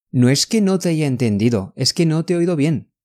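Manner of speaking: quick, fluent run without hesitation; natural rapid-fire delivery; all at once. Shown as a demonstration. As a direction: say it in one fast breath. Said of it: "这个要一下子".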